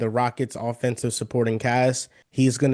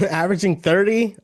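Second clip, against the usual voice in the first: laughing disbelief, higher and louder; can't-believe-it reaction with a laugh; incredulous; 7 semitones higher, 5 dB louder than usual. Real, not acted. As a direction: question it with an amused, raised voice. Scoffs at a high projection.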